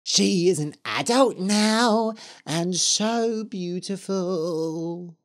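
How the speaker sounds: slow eerie witch voice; a hint of a teasing ghost witch. A narrator's reading. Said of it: "said the Red Witch".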